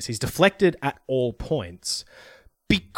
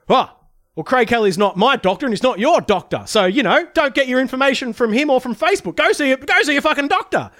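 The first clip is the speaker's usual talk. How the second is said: high, exasperated mock politician voice; mocking Morrison; 8 semitones higher, 4 dB louder than usual. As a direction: ham up a fed-up prime minister. Mocking Morrison at press club.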